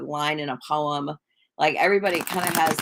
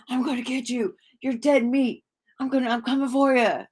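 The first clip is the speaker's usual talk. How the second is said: lightly mocked yelling tough guy, pitched up; Mister T; 7 semitones higher than usual. Vlogger imitating Mister T yelling on screen, mock yell. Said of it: "like, yelling the whole".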